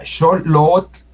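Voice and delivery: xolot with short first O and held second O; second O long. Shown as a demonstration. Teacher giving the word for parrot, second O long. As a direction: stretch only the last vowel of xolot. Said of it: "con la segunda O larga".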